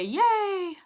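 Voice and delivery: high-pitched drawn-out cheer; happy cheer. Real, not acted. Cheering a student's thanks.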